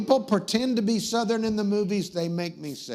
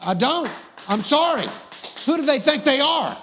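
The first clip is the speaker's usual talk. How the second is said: loud, high comic indignation; indignant outburst; 7 semitones higher, 5 dB louder than usual. Acted. Host ranting about British royalty, indignant outburst. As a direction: burst out in mock outrage.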